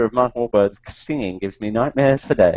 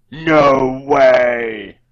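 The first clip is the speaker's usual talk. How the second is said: defiant shout; 2 semitones lower than usual. Acted. Voicing a robot who won't stand down, defiant shout. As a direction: shout it, refusing to back down.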